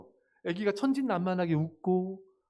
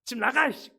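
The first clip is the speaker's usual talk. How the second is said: raised, higher acted outburst; harsh parental scolding; 6 semitones higher, 4 dB louder than usual. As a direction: throw the child out like a fed-up parent. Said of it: "이렇게 막 야단치고 막". (부모가 세게 야단치는 흉내)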